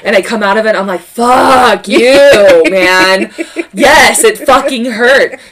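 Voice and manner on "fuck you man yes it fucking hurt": louder and higher, mock yell at mom; hammed-up pain-fuelled outburst; retold angry yell. Retold snapping at mom mid-contraction.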